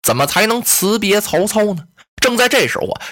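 ordinary delivery, nothing marked

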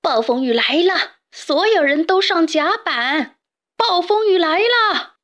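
captain bellowing all hands on deck; shouting, building. Acted. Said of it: "喊道".

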